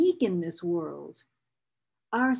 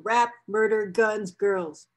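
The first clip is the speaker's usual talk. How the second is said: ordinary delivery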